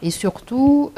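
ordinary delivery

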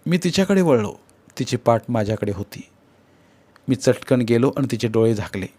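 ordinary delivery

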